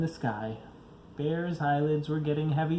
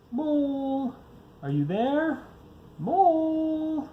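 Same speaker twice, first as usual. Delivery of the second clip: calling out; 13 semitones higher, slower than usual. Acted. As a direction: call down the tunnel, hoping he hears. Bear calling down to Mole.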